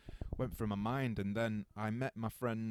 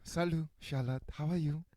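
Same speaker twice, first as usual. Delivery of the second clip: French accent; 6 semitones higher than usual. Shown as a demonstration. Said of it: "I'm gonna say it with a French accent".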